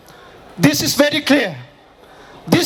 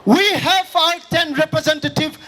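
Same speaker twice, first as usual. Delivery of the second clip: rally speech; 4 semitones higher than usual. Acted. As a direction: rouse the crowd, voice raised. Fiery speech to crowd at rally.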